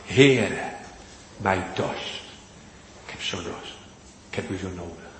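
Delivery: softly pleading prayer, dropping almost to a whisper; hushed prayer. Pretend. A preacher voicing a sinner's plea to the Lord, hushed. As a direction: breathe it like a desperate confession to God. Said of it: "Maar zeg het dan ook".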